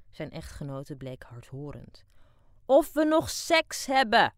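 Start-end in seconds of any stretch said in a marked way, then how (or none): 2.6-4.3 slow, loud, high shout to a deaf wife. Acted. Old husband shouts the question to his deaf wife. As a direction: bellow it so she hears. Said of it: "schreeuwde de jubilaris".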